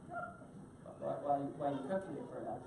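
low, soft, stammered question; quiet retold reply. Speaker questioning the pastry chef, low and quiet. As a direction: ask it haltingly under your voice.

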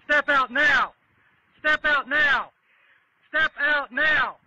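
loud, forceful orders; shouting commands. Real, not acted. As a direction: bark the order at full volume. Officer ordering driver out.

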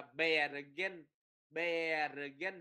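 "Bergen" with a long "e", like "be-rơ-ghen"; đọc sai âm E. Shown as a demonstration. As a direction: stretch the first vowel of "Bergen" as "e". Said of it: "Bergen là sai". ("Bergen" đọc "be" kéo dài)

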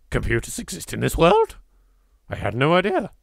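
ordinary delivery

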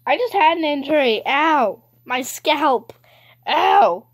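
loud ow of complaint; pained outcry. Real, not acted. Player who just hurt their scalp, pained outcry. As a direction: cry out loudly, stung by a knock.

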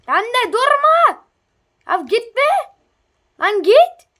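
loud, high shout to drive it off; shouting it away. Real, not acted. Shooing a character off the boat, loud high shout. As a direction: yell at it to go away.

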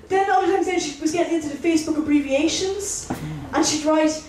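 ordinary delivery

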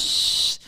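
a whispered hiss of a shush; crowd shush. Retold or imitated. Imitating the crowd shushing the comic on stage. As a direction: hush him like a bored audience. Audience hushing him.

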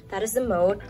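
ordinary talk

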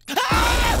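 very high, loud shriek; terrified scream. Real, not acted. The monster appears out of nowhere, a loud shriek.

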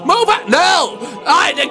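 normal talking voice

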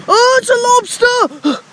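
high, drawn-out startled shout; shocked cry. Real, not acted. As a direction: react to spotting a lobster. Sudden lobster sighting.

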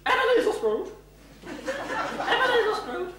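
high, shrill-free bossy matron voice; stern matron. Acted. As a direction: scold like a strict school matron. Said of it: "How dare you make me sound like a parrot?".

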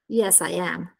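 "Yes, I am." low and soft, settling down; umbrella intonation answer. Shown as a demonstration. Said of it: "la forma dell'ombrello c'è lo stesso".

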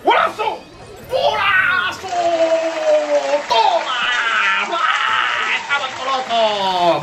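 high, yelled goal celebration; breakneck goal commentary. Real, not acted. Scores a goal in game.

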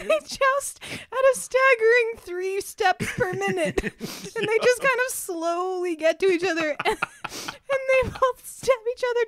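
slow, high and loud through laughter; laughing narration. Real, not acted. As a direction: tell it while cracking up. Comic description of a joust.

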